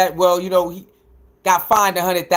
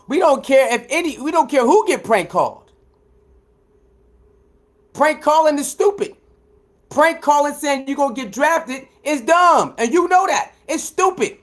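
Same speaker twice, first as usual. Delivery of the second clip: heated rant; 6 semitones higher than usual. Real, not acted. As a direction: tear into the hater, rising with each point.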